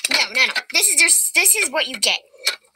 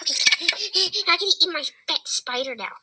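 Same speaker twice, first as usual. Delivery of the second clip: usual speaking voice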